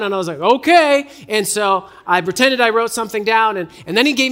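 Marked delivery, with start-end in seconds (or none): 0.4-1.1 high, loud, obliging mock grandpa; grandpa in character. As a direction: play along obligingly, voice pitched up. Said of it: "in, in character".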